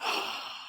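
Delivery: sharp re-enacted intake of breath; acted gasp of amazement; surprised gasp. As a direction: gasp at a sudden windfall.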